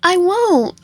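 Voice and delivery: put-on high sweet yandere girl voice; cute high voice. Cute character voice in game.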